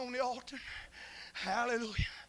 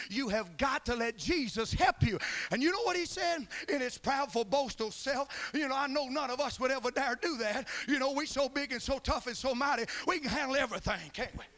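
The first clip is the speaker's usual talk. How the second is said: fervent preacher's cadence; preaching; 2 semitones higher than usual. Acted. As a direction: preach it to the congregation. Preaching at full force.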